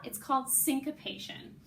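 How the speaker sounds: ordinary talk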